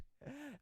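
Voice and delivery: short, high giggle; laugh. Real, not acted. Reader amused by the article, short high laugh. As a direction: break into a quick laugh.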